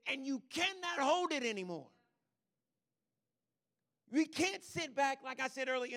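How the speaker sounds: loud, high preacher's cry; full-force preaching. Acted. Preacher urging the church, loud and high. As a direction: push it out like a fire you can't hold.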